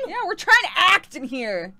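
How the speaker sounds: indignant call-out. Real, not acted. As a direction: holler it toward someone outside. Joins yelling at the worker outside.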